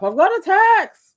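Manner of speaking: put-on high excited girl squeal. Friend imitating Billikis announcing a text, high squeal. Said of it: "She sounds like".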